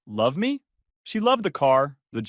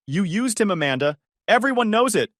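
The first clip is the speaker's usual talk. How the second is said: higher, louder screamed retort; screaming back; 7 semitones higher, 4 dB louder than usual. A narrator's reading. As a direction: yell right back at her. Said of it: "Haley was screaming back".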